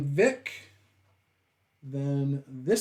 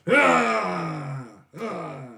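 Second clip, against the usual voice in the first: brutish groans; 4 semitones higher, 5 dB louder than usual. Acted. Game master's brute points outside for the party, grunting groans. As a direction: groan wordlessly as if giving directions. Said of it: "He speaks in grunts and groans".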